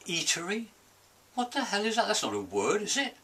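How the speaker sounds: incredulous, high and rising; outraged squawk at a silly word; indignant disbelief. Acted.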